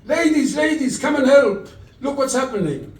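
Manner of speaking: high, loud frantic cry to the ladies; screaming for help. Retold or imitated. Woman in the street screaming as a boy is snatched, shrill and loud. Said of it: "was screaming".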